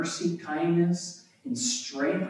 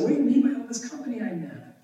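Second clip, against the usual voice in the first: high-pitched, quicker questioning voice; Esau's puzzled question; 9 semitones higher, faster than usual. A narrator's reading. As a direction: ask it high and fast, as if puzzled. Reads Esau's puzzled question aloud.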